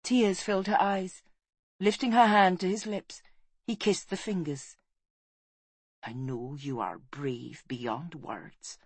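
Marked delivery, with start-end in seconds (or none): 6.0-8.8 slow, soft, lowered voice; hushed, low farewell; tender reassurance. A narrator's reading. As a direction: comfort the dying gently and slowly. Man comforts a dying queen.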